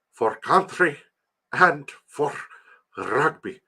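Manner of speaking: halting South African accent with rolled R's; deliberate mock South African accent. Shown as a demonstration. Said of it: "You have to roll your R's".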